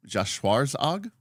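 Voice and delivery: "Schwarzauge" slowly, rising high into a question; unsure pronunciation. Shown as a demonstration. Streamer asking if the German title is right, unsure "Schwarzauge". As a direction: try "Schwarzauge" tentatively in American English. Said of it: "Would that be how I would pronounce that?".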